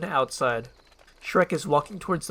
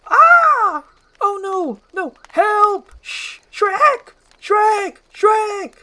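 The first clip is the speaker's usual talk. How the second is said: high, screaming panic; frantic shrieking donkey, very loud; freaking out; 13 dB louder, much slower than usual. Acted. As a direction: scream for help in sheer terror. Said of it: "Donkey starts freaking out".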